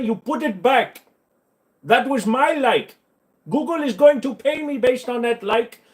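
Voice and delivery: playful fake fury at a bad mod; mock scolding. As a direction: scold the mods like a betrayed parent. Mock scolding mods over likes.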